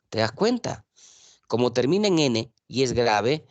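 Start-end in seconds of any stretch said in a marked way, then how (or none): none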